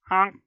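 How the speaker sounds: mock toy honk. Shown as a demonstration. Imitating toy noise.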